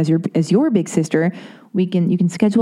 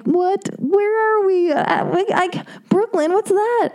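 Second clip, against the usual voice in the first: much higher put-on airhead voice; ditzy confused voice; 13 semitones higher, slower than usual. Mocking herself to her boyfriend in a ditzy, baffled voice. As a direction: play dumb, baffled and lost. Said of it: "And I'm like".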